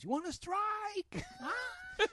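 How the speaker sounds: high, drawn-out challenge; mock threatening yell. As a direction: imitate a crazed uncle yelling slowly. Uncle's threat while smashing windshields.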